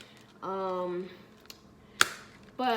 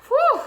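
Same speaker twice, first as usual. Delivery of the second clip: sharp, high "whew" of exhalation; big high-pitched whew; high loud whew; 14 dB louder than usual. Real, not acted. Relieved exclamation after showing haul.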